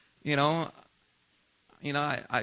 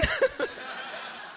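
breathless high laugh; 5 dB quieter than usual. Real, not acted. After mock complaint.

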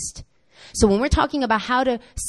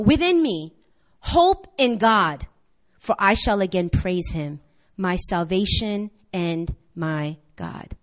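slow scripture reading; 2 semitones lower, slower than usual. Shown as a demonstration. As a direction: read each word slowly and solemnly. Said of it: "watch what he does".

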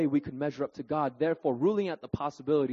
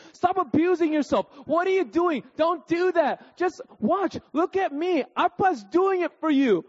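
put-on higher, louder scolding of a child; exasperated dad; 11 semitones higher, 5 dB louder than usual. Dad scolds his flailing toddler, louder exasperated voice. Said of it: "dad scolding toddler".

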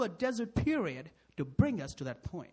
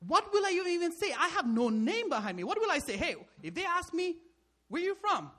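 pleading Moses; 8 semitones higher than usual. Acted.

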